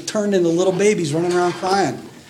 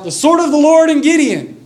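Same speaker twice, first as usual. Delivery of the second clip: battle shout; 9 semitones higher, 5 dB louder than usual. Retold or imitated. Gideon's men shouting as they surround the camp, battle shout. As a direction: shout it like a war cry. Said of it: "and shout".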